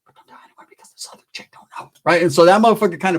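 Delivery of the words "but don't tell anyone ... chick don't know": fast, hushed whisper; whispered secret. Retold or imitated. Telling the paisa a secret behind his girlfriend's back, a fast whisper. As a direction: whisper it so the girlfriend can't hear.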